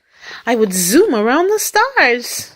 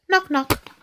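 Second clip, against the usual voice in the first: two short higher-pitched knocks; knock on door; 6 semitones higher than usual. A narrator's reading. Reader shows the door sound, short knocking words. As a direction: rap out the words like a hand on a door. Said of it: "like the hand on a door".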